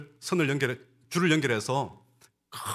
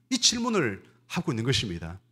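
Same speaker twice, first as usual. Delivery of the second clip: ordinary talk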